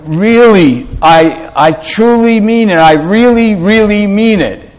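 really and truly pushed high, insisting; emphatic. Shown as a demonstration. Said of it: "Like when we say".

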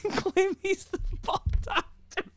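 squeaky, high laugh-crying words. Real, not acted. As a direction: choke it out while laughing hard. Laughing through a joke.

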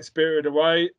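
ordinary delivery

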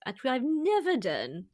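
normal talking voice

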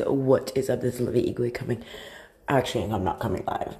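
ordinary delivery

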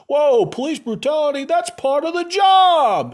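high, loud caricature of a cop; hammed-up yelling, stretching the last words; mock indignant cop. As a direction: imitate a cop loudly brushing it off. Mimics cops defending brutality.

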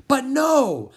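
high, loud exasperated cry; exasperated protest. A narrator's reading. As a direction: object in disbelief. Gaston protesting again.

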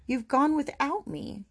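high, plaintive lament; crying out. Acted. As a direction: cry it out high, without loudness. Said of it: "And he cried".

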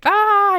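loud, high-pitched "aah" of exasperation; mock frustrated yell. Frazzled mom escalating with her kids, mock yell. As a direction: let out a short imitated scream of overload. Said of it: "yell at them".

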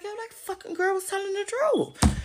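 high, laughing mock gasp of disbelief; squeaky laughing mock surprise; mock shocked realization. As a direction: play them stunned that she was right, laughing. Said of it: "then you gonna be shocked".

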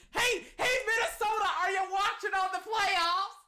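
high, loud call-out; taunting shout. Real, not acted. Taunting Minnesota after a big catch, loud high call. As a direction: holler it at a rival team.

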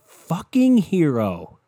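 loud, high, drawn-out acclaim; hammed-up hero worship; mock-awed exclamation. Real, not acted. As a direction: belt it out in slow, over-the-top praise. Mock praise of the televangelist.

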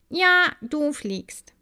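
high, slow affirming; the honey carrier assures Maya she is flying. A narrator's reading. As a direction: confirm it gently and slowly. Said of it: "sagte die Honigträgerin".

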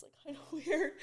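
giggling through the words; laughing. Real, not acted. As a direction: break into a real embarrassed laugh. Embarrassed aside about camera angle.

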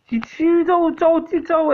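high hammed-up Liverpool accent; botched Liverpool accent; mock Scouse accent. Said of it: "just murdered your accent".

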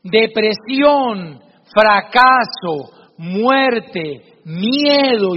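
very slow, each word set apart and heavy; solemn father teaching a lesson; slow, weighty words. Acted. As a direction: lay each dark word down slowly for the boy. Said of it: "lo toma de sus hombros y le dice".

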